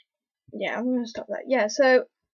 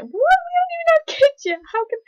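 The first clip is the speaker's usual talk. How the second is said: high, loud disbelieving "What?"; shocked quote; 8 dB louder than usual. Retold or imitated. Flash reacting to Impulse's news, high and loud shock. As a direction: squeak it out in disbelief. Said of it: "and he's like".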